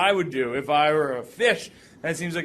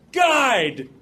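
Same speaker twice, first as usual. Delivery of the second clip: higher, louder call for help; urgent plea; 7 semitones higher, 5 dB louder, slower than usual. Retold or imitated. Speaker begging the guide to act, urgent call. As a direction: demand action from the guide.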